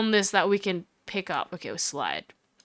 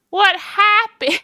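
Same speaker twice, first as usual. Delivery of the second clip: high, slow Southern drawl; 8 semitones higher, much slower than usual. Acted. Interrupted woman answers the lawyer, Southern drawl. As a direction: stretch it out in a high Southern twang. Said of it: "she sounds like that now".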